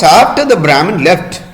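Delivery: plain reading voice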